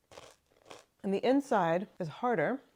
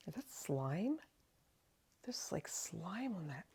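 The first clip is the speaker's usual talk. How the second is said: hushed, grossed-out whisper; disgusted by smell; 11 dB quieter, slower than usual. Real, not acted. Sees slime on the cheese.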